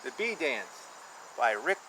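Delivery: ordinary delivery, nothing marked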